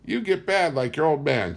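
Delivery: a hint of the high whining mother; mild whiny scolding; lightly voiced nagging mother. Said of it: "she whined".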